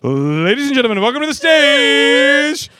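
magician announcer. Pretend. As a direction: introduce the act with fanfare. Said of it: "greatest magician ever".

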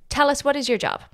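ordinary talk

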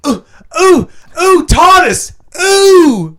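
David Tennant impression. Put on the spot, a camp Tennant impression. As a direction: coo it out like a bad Tennant. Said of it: "David Tennant".

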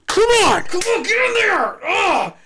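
high, loud straining yell; straining shout. Acted. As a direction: grunt it out like forcing stuffing in. Ezekiel shoving bread, grunting.

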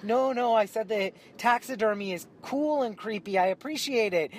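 earnestly smooth it over; defensive explanation. Retold or imitated. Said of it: "Michelle was like".

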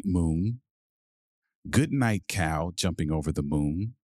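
usual speaking voice

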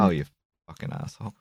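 unvoiced muttered curse; muttered insult. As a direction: breathe the insult under your breath. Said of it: "And it's like".

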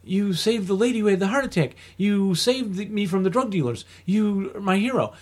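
a lightly voiced pleading Ray. Ray begging his hero to stay, lightly voiced.

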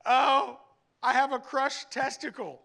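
high, loud mock child wail; dad imitating daughter's panicked cry; panicked little girl. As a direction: squeal it like a panicked kid. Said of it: "she said".

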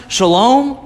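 usual speaking voice

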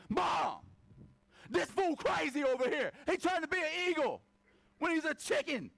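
tattling chickens. Retold or imitated. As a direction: call out to mom like a tattling kid. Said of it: "they're like".

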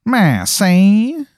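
high, drawn-out spooky call; a hidden voice calling out; mysterious voice from the dark; eerie call. Retold or imitated. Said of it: "suddenly you hear".

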